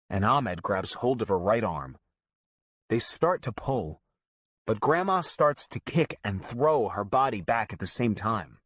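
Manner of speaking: usual speaking voice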